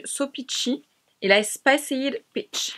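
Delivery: slow, hammed-up English accent on the shade names; bad English accent. Shown as a demonstration. Vlogger showing off blush shades, overdone English accent, slow. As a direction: give the English accent everything, stretching each name. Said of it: "tout donner avec mon accent anglais de merde".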